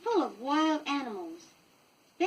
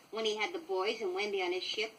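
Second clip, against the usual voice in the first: ordinary delivery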